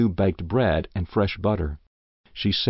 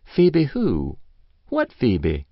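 put-on puzzled old woman; 4 semitones higher, much slower than usual. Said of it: "replied Missus Race".

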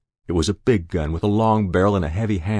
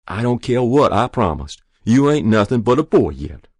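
loud, raised-pitch retort; scolding refusal; 4 semitones higher, 7 dB louder than usual. A narrator's reading. Mother overruling her son, scolding. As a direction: shut him down firmly.

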